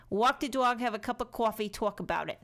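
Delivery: playful put-on Boston accent. Crafter joking mid-tutorial about taking a coffee break, mock Boston accent. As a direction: lean into a hammed-up Boston or New York accent. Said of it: "That's my Boston accent".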